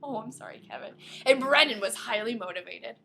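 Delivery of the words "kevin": ordinary talk, nothing marked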